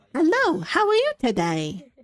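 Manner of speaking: put-on rising high-pitched kid's hello; mimicking a four-year-old. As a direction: chirp it like a little kid.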